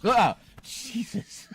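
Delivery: startled yelp; jump-scared shout; scream into giggles. Real, not acted. Startled by cat appearing.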